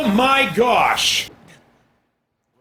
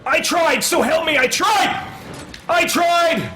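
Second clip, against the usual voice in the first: desperate cry; 8 semitones higher than usual. Real, not acted. Dying in game.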